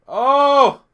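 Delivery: high, loud, drawn-out "oh!"; real shouted gasp of reaction to the game. Real, not acted. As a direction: belt out a startled "oh!".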